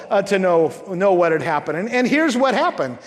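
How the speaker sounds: ordinary talk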